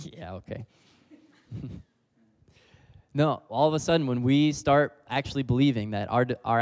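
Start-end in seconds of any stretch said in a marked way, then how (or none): 0.0-1.7 sheepish laugh. Real, not acted. Reacts to God's rebuke.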